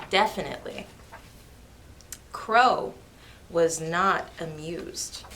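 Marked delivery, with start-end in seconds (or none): none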